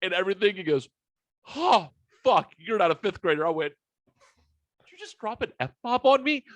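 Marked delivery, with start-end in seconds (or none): 1.6-3.4 high-pitched imitated double-take; mock shock of sudden recognition; startled realisation. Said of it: "he finally seen the beard". 4.8-6.4 very high, incredulous retold question; acting out stunned indignation, high-pitched; flabbergasted. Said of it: "I was so flabbergasted".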